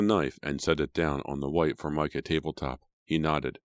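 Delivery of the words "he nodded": usual speaking voice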